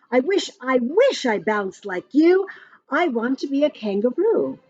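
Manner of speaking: plain reading voice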